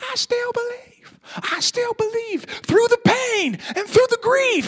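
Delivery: high, strained mock scream of the lyric; imitating a screaming singer. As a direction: belt it out like the singer at the song's end. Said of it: "he's pretty much screaming those words".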